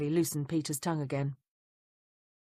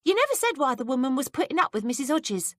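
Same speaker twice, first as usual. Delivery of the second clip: put-on higher, curious young maid; higher maid's voice, prying; 8 semitones higher, 4 dB louder than usual. A narrator's reading. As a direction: play the nosy young maid, pitched up. Betsy voiced by reader.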